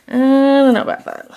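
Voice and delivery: a loud, high, punchy "I DON'T know about that"; emphatic doubt. Shown as a demonstration. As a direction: lean hard into "don't" like a real verdict.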